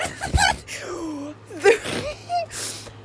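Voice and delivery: high, drawn-out wheezy giggling fit; helpless laughter. Real, not acted. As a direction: crack up and squeal with laughter. Cracks up over Fleabag.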